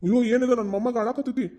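put-on high-pitched soothing baby talk; concerned cooing. Grandfather asks crying grandson what's wrong, cooing. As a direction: coo over the crying child.

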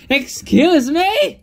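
high, slow indignant shriek; screaming. Acted. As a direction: stretch it out in outrage. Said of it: "Natsuki screams".

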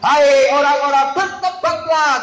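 loud, drawn-out mock street-preacher call; imitated child preacher. Teacher imitates Rafael preaching from a tabletop, mock loud proclamation. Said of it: "dia naik ke atas meja".

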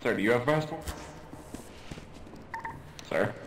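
normal talking voice

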